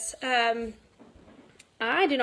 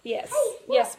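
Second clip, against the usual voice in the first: usual speaking voice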